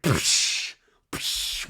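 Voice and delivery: voiceless puffed "psh" sounds; dismissive scoff. Retold or imitated. Janet retold waving off Doty's excuse, dismissive scoffing. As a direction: blow it off with airy hisses.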